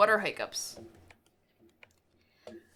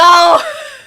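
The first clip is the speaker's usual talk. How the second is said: loud laugh; 11 semitones higher, 12 dB louder than usual. Real, not acted. Ads interrupt her mid-sentence.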